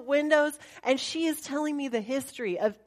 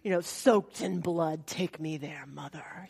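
put-on spooky kids; 6 semitones lower, 6 dB quieter than usual. Kids demanding the Colosseum, eerie hushed whisper. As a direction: whisper it like creepy children. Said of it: "they're like".

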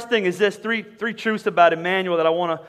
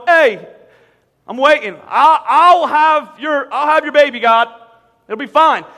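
higher, louder, drawn-out mock Mary; lightly mocking, louder and higher teen voice; mock carefree Mary; 6 semitones higher, 8 dB louder than usual. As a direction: ham up a breezy Mary who isn't fazed.

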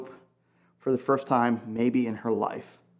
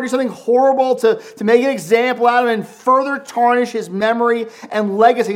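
much higher and louder, rising panic; desperate, full-voiced lament; anguished outcry; 11 semitones higher, 11 dB louder than usual. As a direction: cry out Mary's fear for his body. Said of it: "She's going like".

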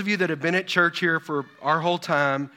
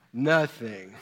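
low, lightly acted denial; a hint of a kid caught out; mock-innocent reply to mom, pitched down; guilty son; 7 semitones lower than usual. Acts out guilty son's reply.

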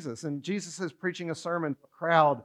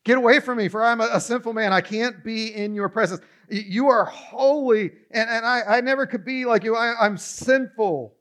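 louder, higher, pleading cry of unworthiness; anguished plea; 6 semitones higher, 5 dB louder than usual. Acted. Peter on his knees begs Jesus to leave, anguished and loud. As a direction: beg him to go, overwhelmed by guilt. Peter's confession, acted with feeling.

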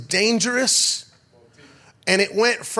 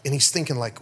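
ordinary delivery

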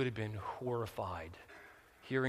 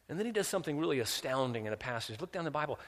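ordinary talk, nothing marked

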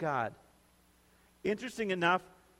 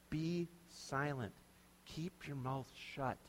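slow, softer gentle be silent, each word eased out; nicer way; 8 dB quieter, slower than usual. Shown as a demonstration. Preacher showing the gentler way to say shut up, slow and soft. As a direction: say it kindly and softly, slowly. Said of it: "He just says it in a nicer way".

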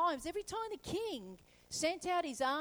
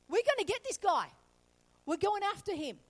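higher, louder threat; determined king; 5 semitones higher, 5 dB louder than usual. Retold or imitated. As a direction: vow to hunt him down. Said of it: "the king came and went".

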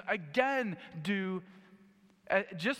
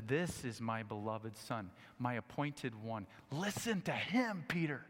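much lower, softer and slow; God in the cloud naming his Son, quietly; hushed proclamation; 7 semitones lower, 8 dB quieter than usual. Acted. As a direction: speak it low and solemn.

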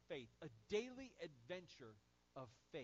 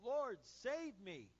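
loud, high, slow cry for help; desperate cry; 6 semitones higher, 8 dB louder, much slower than usual. Retold or imitated. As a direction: shout it as if sinking. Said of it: "cried out".

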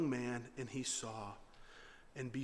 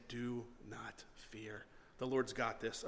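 very soft, low and slow; quietly steady a frightened heart; calm reassurance; 6 semitones lower, 10 dB quieter, slower than usual. Acted. Someone comforting those falling apart, calm reassurance. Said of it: "we need to hear someone say".

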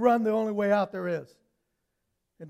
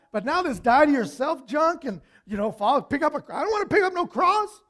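loud, high-pitched griping; indignant complaint; 6 semitones higher, 8 dB louder than usual. Acted. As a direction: protest like a fed-up follower, getting more worked up. Disciples acted out complaining to Jesus.

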